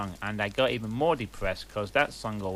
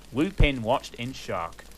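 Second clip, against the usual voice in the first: ordinary delivery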